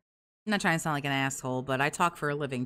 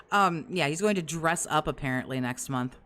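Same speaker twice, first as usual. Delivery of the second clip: ordinary talk, nothing marked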